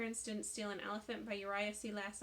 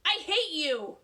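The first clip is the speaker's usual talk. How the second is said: loud, high "Go away"; boy yelling at the teacher outside; 8 semitones higher, 14 dB louder, slower than usual. Acted. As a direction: yell through the door. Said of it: "he yelled".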